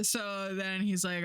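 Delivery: ordinary delivery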